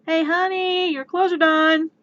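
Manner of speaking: high, chirpy household call; sweetly interrupting partner. Acted. Partner calling the brooding hero about laundry, high singsong.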